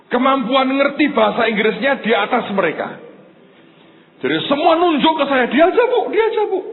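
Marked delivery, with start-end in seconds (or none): 5.5-6.6 high, quick classmates volunteering someone else; eager classmates. Retold or imitated. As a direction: imitate students hurriedly nominating a classmate. Classmates all point at him.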